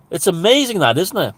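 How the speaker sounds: ordinary talk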